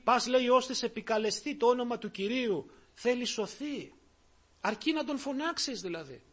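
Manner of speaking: normal talking voice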